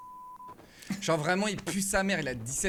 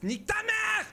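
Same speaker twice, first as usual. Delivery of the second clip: screaming in rage, much higher; furious shout; slower than usual. Real, not acted. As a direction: yell it out in pure fury. Raging at a teammate in game.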